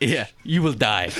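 slow mock French accent; deadpan French-accented verdict; French instructor. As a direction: shrug it off with a fake French accent. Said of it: "in France, they just go".

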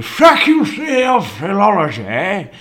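high, mock-fancy put-on voice; loudly mock the institution's name; mocking name-drop. Mocking name of his old college.

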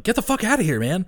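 high, urgent yell; urgent shout. Retold or imitated. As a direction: shoo him off fast. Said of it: "Peter's then like".

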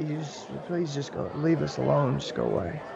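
soft, low, pleading whisper of a scared woman. Acted. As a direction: beg them quietly to leave. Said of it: "the woman starts to say".

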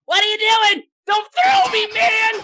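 high, loud squawking gnome voice; shrill little stowaway yelling; panicked gnome. Acted. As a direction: shout in protest as a startled little gnome. Gnome tumbles from thrown barrel, protesting.

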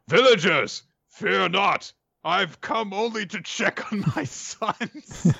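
a grand werewolf speaking slowly from above. Retold or imitated. Werewolf addresses the villagers.

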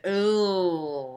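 gurgling ooh with liquid in mouth; water held in mouth. Real, not acted. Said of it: "thought you were trying to talk with water in your mouth".

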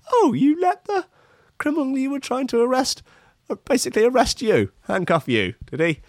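very high, hammed-up mock incredulity; sing-song ridicule, starting very high and easing down; mocking boss. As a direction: tease the hapless officer in a high put-on voice. Acted telling-off at police station.

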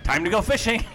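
high, bright burst of glee; eager fishing announcement; gleeful. Acted. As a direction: announce it like a kid off to play. Gleeful line before diving.

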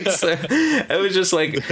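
words bubbling with a laugh. Real, not acted. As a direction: still giggling as you talk. Still laughing.

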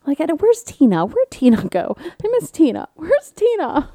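playful pleading for Tina; mock whine. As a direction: whine like a lost child. Missing former barista.

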